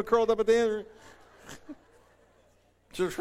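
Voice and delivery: usual speaking voice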